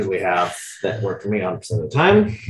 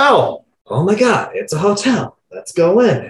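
high, drawn-out mock amazement; feigned surprise; 8 semitones higher, 4 dB louder, slower than usual. Coach playing a man on a walk spotting a hotel, feigned surprise. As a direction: act as if you just stumbled on it.

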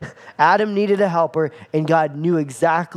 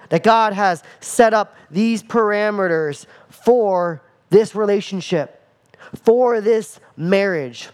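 normal talking voice

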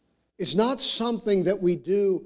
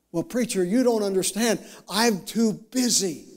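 higher-pitched imitated protest; excuse-making congregant; 6 semitones higher than usual. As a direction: lightly act a parishioner's protest, stretching the end.

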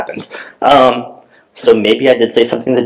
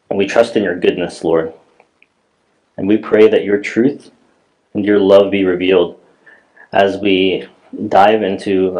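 soft, lower opening prayer before the sermon; 4 semitones lower, 3 dB quieter than usual. Acted. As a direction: pray quietly to God for the congregation.

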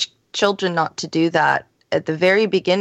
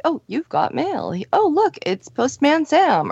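put-on high, bright kids' show host voice; cheery mail guy; 9 semitones higher than usual. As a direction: play the chirpy postman on TV. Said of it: "and they're like".

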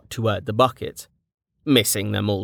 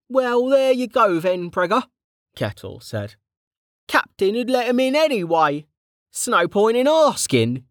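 higher, rough-edged friendly soldier; Kettle's voice; 12 semitones higher than usual. A narrator's reading. Kettle greeting an old friend back from the dead, jovial. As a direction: play the cheeky old comrade, teasing.

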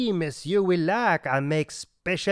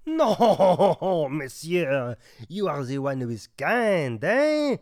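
hammed-up French waiter, rising eh on every line; thick mock French accent, eh after each line; comic French accent; 2 semitones lower than usual. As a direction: play the offended French waiter.

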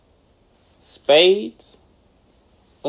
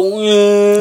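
drawn-out, raised mock zombie moan; slow zombie groan; 4 semitones higher, 5 dB louder than usual. Asking what kind of zombie, imitated slow groan. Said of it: "you give me that slow".

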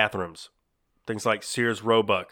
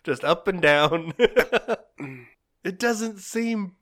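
laughing; 7 semitones higher, 4 dB louder than usual. Real, not acted. Host doubting corn cobs would work, laughing. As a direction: push the words out through giggles. Cracking up over corn cob.

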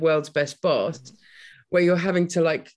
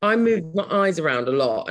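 usual speaking voice

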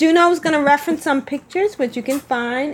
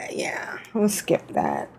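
hushed whisper, much softer; 8 semitones lower, 7 dB quieter than usual. Real, not acted. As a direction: drop to a whisper to skip it. Aside, dropping the subject.

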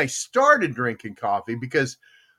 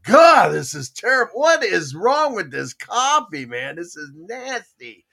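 loud disgusted exclamation; retold disgust at moldy coffee; disgusted outburst; 6 semitones higher, 6 dB louder than usual. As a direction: spit out the bad coffee complaint. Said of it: "and it was like".

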